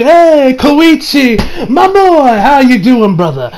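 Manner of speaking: high, loud, jovial call-out; hearty greeting. Retold or imitated. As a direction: hail an old pal, big and high. Voicing character's hearty greeting.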